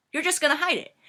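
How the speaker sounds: high, loud disbelieving repeat; acted-out incredulity; incredulous echo. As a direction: throw it back in disbelief. Incredulous echo of friend's plan.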